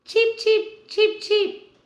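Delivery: very high, loud chirpy cheeps; chick cheeps. Acted. Grandma cheeps high and loud for the chicks. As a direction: peep way up like a tiny chick.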